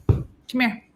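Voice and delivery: ordinary talk, nothing marked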